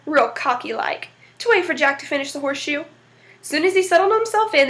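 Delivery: ordinary delivery, nothing marked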